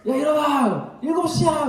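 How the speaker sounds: put-on high, loud yell to wake a child; wake-up yell at a sleeping son; mother's shout. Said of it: "어머님들 목소리들이 막 커지죠".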